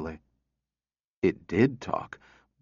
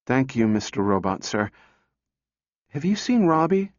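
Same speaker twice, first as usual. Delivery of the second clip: put-on little girl voice; 3 semitones higher than usual. A narrator's reading. Little girl asks robot.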